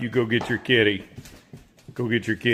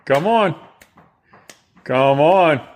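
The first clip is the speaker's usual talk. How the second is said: calling; 4 semitones higher, 6 dB louder, slower than usual. Real, not acted. Calling the pet inside.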